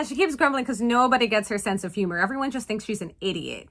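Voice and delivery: ordinary talk, nothing marked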